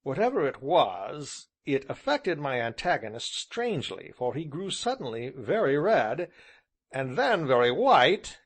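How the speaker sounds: ordinary delivery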